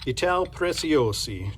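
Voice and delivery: put-on slow, hammy Italian accent on the brand name. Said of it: "I apologize for my hideous Italian accent".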